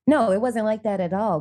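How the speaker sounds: usual speaking voice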